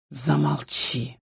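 low, slow, soft command; menacing hush. Acted. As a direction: hush her with quiet threat. King silences the queen. (тихо и угрожающе)

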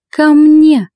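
high loud shriek; piercing command from the tipsy friend; shrieked call. A narrator's reading. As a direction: shriek it like a wild summons. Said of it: "взвизгнула несносная подруга".

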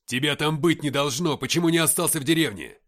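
loud, heated reproach; angry scolding. A narrator's reading. Ignat berating the captain for being there, angrily. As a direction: scold him loudly over the radio. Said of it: "выругался Игнат".

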